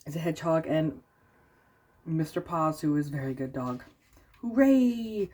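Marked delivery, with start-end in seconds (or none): 4.4-5.3 cheering. Acted. Friends cheer.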